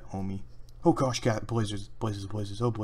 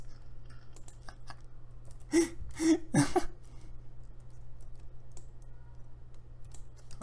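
high unvoiced giggle; 6 dB quieter than usual. Real, not acted. Laughs after begging.

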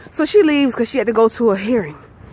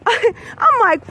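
laughing through tears; 9 semitones higher than usual. Real, not acted. Retelling shock at lawyer's remark.

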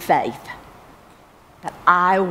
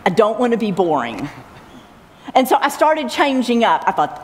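usual speaking voice

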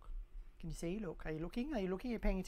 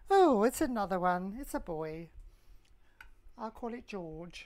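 acted surprise; 9 dB louder than usual. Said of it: "and you go".

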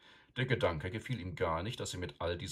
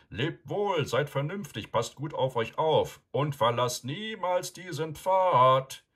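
high, loud call over a long distance, slower; calling from afar; 9 semitones higher, 9 dB louder, slower than usual. A narrator's reading. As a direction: shout it through cupped hands to riders far behind. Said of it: "legte die Hände an den Mund und rief". (lauter, hoher Ruf aus der Ferne, langsam)

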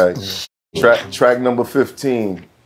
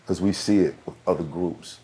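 usual speaking voice

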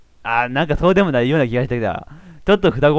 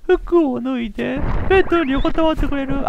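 seductive woman; 13 semitones higher than usual. Acted. A woman luring the listener to bed, high and coaxing.

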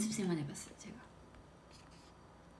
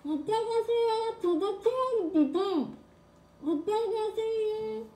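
much higher, slow, muffled; high voice through covered mouth; 13 semitones higher, 3 dB louder, slower than usual. Shown as a demonstration. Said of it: "제 개인기".

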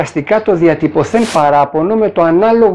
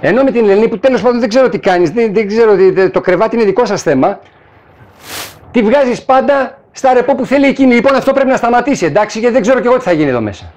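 raised, higher angry employee voice; angry complaint; 6 semitones higher, faster than usual. Retold or imitated. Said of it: "ανεβάζει τον τόνο της φωνής του καθώς τα λέει αυτά". (θυμωμένο παράπονο με υψωμένη φωνή)

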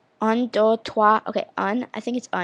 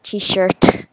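loud French-accented T-SHIRT; 8 dB louder than usual. Shown as a demonstration. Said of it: "but, like, in a French accent".

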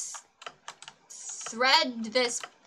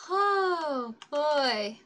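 usual speaking voice

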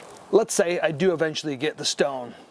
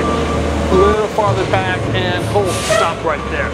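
calling out; 3 semitones higher, 3 dB louder than usual. Real, not acted. Calling to truck driver.

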